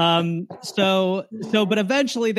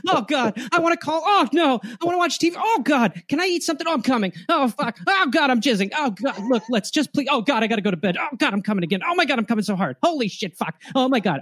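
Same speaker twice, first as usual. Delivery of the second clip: high, breathless moaning exclamations; mock orgasm moans; 8 semitones higher than usual. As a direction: ham up someone climaxing nonstop. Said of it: "this is how the person who's having twenty thousand orgasms is going".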